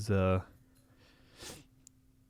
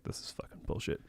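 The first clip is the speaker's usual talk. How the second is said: hushed, breathy curse under the breath; whispered frustration; 13 dB quieter than usual. Retold or imitated. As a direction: whisper it in disgust. Frustrated reaction to TV show.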